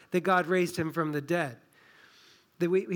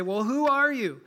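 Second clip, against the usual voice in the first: exasperated demand; 6 semitones higher, 5 dB louder than usual. Acted. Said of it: "They finally just say".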